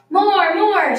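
high, loud clamour for an encore; shouting. A narrator's reading. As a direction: shout eagerly like a group of children. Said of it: "shouted the children".

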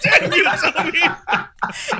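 slow, drawn out through laughter; laughing curse. Retold or imitated. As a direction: drag it out while laughing. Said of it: "I was like".

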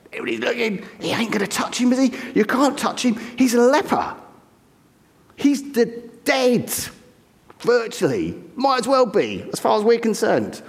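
high, incredulous voice of an onlooker; shocked disciples. Retold or imitated. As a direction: protest in disbelief that he'd touch a leper.

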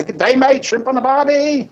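put-on playful Australian accent; mock Australian. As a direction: ham up the Aussie catchphrases. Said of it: "that's what you think of".

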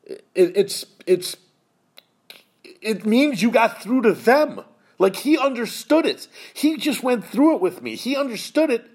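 halting, then high and emotional; real choked-up voice, pitched high. Real, not acted. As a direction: fight through tears rising in pitch. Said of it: "This one's gonna get me a little".

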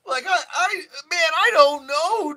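high, loud stoner voice; cartoon stoner fumbling for words; Shaggy voice. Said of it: "doing the stoner voice".

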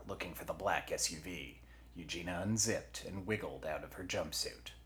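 usual speaking voice

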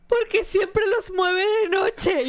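put-on high, drawn-out childish protest; slow high-pitched mock complaint; smart-aleck little girl. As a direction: play a cheeky kid demanding answers. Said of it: "la tía Amy ya, listilla de chiquilla".

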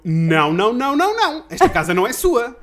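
retold loud, high-rising string of protests; alarmed refusal. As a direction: burst out loud and high to block an intruder. Confronts landlady trying to break in. (protesto alarmado, alto e agudo)

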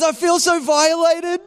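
high-pitched wail of mock outrage; hammed-up wounded indignation; worked up. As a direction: imitate a distraught victim crying out. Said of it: "He was all worked up".

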